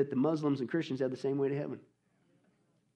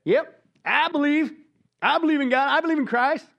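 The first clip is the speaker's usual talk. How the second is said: much higher and louder, showy claim of faith; mocking fake believer; 11 semitones higher, 7 dB louder than usual. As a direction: ham up a loud hollow profession. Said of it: "and they say this".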